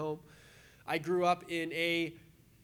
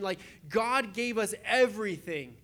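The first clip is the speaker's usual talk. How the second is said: ordinary delivery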